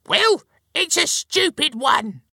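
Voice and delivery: very high, loud cross wife; piercingly high, argumentative; squabbling retort. A narrator's reading. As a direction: squawk back scornfully. Wife argues back, squabbling.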